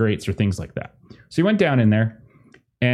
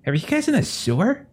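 high, fumbling stranger; scrawny guy voice; 5 semitones higher than usual. Acted. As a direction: ramble nervously.

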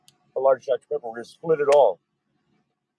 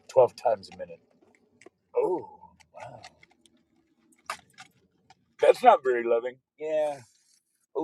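usual speaking voice

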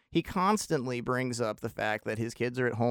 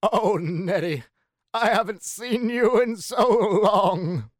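high, loud, slow Katharine Hepburn voice, drawn out; a hammed-up Katharine Hepburn gushing at an old friend; Katharine Hepburn impression; 8 semitones higher, 7 dB louder, slower than usual. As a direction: Katharine Hepburn impression, much higher and louder, stretched out slowly. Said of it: "let me do my Katharine Hepburn impersonation".

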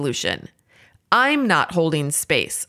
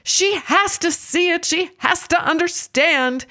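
a mild mock scream, pitched way up; 11 semitones higher than usual. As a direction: act out an urgent inner alarm in a high voice. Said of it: "my brain's programming is screaming".

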